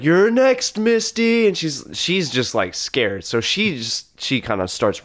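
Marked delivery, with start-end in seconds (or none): 0.0-1.5 high loud threat; villain threat. Acted. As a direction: call out the threat, pitched up. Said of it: "he's like".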